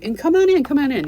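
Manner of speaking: eager welcome. Retold or imitated. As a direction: wave her inside warmly.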